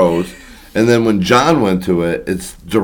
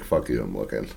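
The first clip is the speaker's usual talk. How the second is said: much softer and lower, muttered under breath; muttered curse; 13 semitones lower, 14 dB quieter than usual. Retold or imitated. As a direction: grumble it to yourself. Retold snap at CVS clerk.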